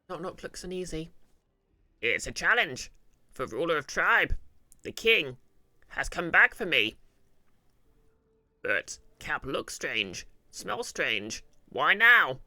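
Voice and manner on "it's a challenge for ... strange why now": loud, slow goblin voice with big pitch swings; troubled goblin. Acted. Goblin recalling how the king beat him, troubled and loud. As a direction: play an uneasy goblin, loud and drawn out.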